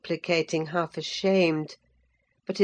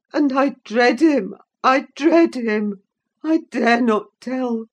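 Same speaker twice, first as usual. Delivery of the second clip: frightened gasping; 7 semitones higher, 6 dB louder than usual. A narrator's reading. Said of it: "gasped the boy".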